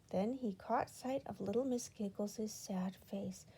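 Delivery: plain reading voice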